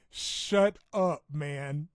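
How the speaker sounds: giggling shut up; laughing retort. Real, not acted. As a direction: toss it back through laughter.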